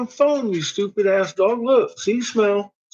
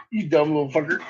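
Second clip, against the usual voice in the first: usual speaking voice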